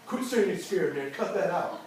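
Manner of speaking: usual speaking voice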